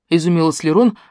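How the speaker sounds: ordinary delivery, nothing marked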